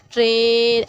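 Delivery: "ट्रेन" with the vowel held long and level. Shown as a demonstration. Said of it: "जब हम ये long में कैसे जाते हैं".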